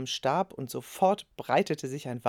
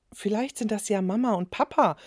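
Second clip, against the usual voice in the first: higher, eager hopeful call; 7 semitones higher than usual. A narrator's reading. Boy calls out hoping his parents arrived. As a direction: jump up hoping it's the parents. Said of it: "rief Ben und sprang auf".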